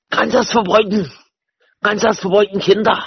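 high, aggressive German scolding; put-on German bark, pitched well up; mock German shouting. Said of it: "speak German so I can shout at people".

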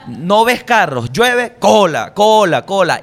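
high, slow, loud, drawn-out cola cola cola; exasperated rant. Real, not acted. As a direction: hammer out the traffic complaint. Ranting about traffic jams. (queja exasperada y arrastrada)

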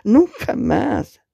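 high, slow cry of ¡Nunca más! stretched out; a woman crying out into the phone as if hit; anguished scream. Acted. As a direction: scream it as if being struck. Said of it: "Grita como si la golpearan".

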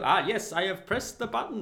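high, loud mock driver voice; hammed-up high, loud reply; mimicked taxi driver. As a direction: pipe up eagerly as the imitated driver. Acts the driver answering the monitor.

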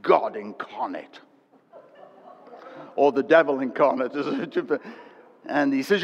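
usual speaking voice

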